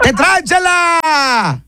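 slow, stretched mock-shocked shout; drawn-out holler. Real, not acted. Host teasing the caller Chela, drawn-out holler. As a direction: stretch the last word into a long call.

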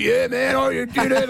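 high, squealing mock cheer; mock excited squeal. Teammate on the bench, imitated in a high squeal. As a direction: ham up the teammate's giddy cheer. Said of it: "He's like".

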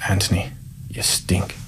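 low, slow near-whisper; quietly disgusted, drawn out and barely voiced; hushed rebuke. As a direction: say it slowly under your breath, unimpressed. Coach rebuking hungover player in story.

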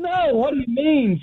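high, startled squeak of innocence; husband protesting his wife's question; surprised denial. Retold or imitated. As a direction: act caught off guard. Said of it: "I'm like".

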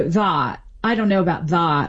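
"the" standing for "that" with the vowel pulled back. Shown as a demonstration. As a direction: say "that" as a backed "thaht", Valley Girl style. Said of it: "more backed".

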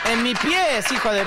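high, loud indignant outburst; outraged yell. Acted. Comedian outraged the aunt talks of the dead in his room, loud yell. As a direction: blurt it out scandalized.

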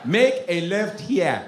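put-on slow, measured African-accented command; African GPS accent. African GPS directing the driver, calm African accent. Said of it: "I have African G P S".

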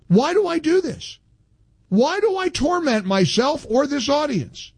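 host groaning before taking a caller, high and exasperated. Real, not acted. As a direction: whine to the heavens about your own choices.

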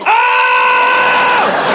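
long, very high comic scream; mock scream. Husband opens wife's picture on his phone, mock scream. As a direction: hold a high-pitched aah in mock horror. Reacts to wife's photo at home.

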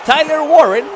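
high, loud escalating goal-style yell; touchdown call. Real, not acted. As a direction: scream the touchdown over and over. Live touchdown call on broadcast.

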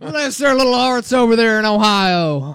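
put-on loud high Southern accent breaking into laughter; laughing Southern mock. Co-host repeating the Southern jab at Ohio State, loud and laughing. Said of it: "here's how it would sound for the South".

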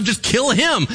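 ordinary talk, nothing marked

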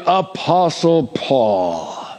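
APOSTLE PAUL drawn out slowly and grandly; pompous emphasis. Acted. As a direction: stretch APOSTLE PAUL into a self-important title. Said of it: "put emphasis on that word".

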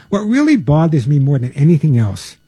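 ordinary talk, nothing marked

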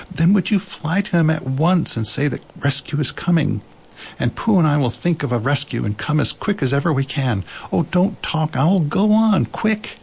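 high, pressing, increasingly impatient; urgent boy. A narrator's reading. Christopher Robin sends Owl to Piglet, urgently. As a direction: hurry him off in a rising pitch.

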